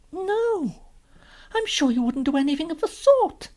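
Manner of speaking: put-on high, loud child's voice; protesting little girl. Lucy refusing to believe the faun, high and protesting. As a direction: object firmly as a trusting girl.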